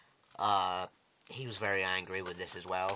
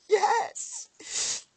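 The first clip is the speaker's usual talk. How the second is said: very high, loud squealed cry; ecstatic cheering; 9 dB louder than usual. Retold or imitated. Viewer re-enacts reaching out to Negan, ecstatic cheer. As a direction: let out a squeal of triumph.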